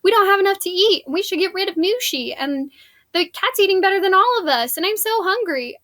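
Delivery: high, fast hungry whine; whiny complaining. Retold or imitated. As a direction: moan like a starving grown man. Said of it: "he's been complaining about".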